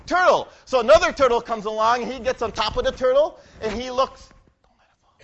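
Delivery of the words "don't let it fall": whisper. Real, not acted. Whispered aside mid story.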